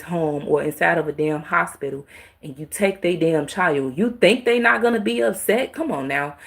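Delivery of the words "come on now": ordinary talk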